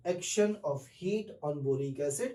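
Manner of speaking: drawn out, word by word, very slow; very slow classroom heading; slow announced heading. Shown as a demonstration. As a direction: spell out the topic title slowly. Teacher announces topic heading.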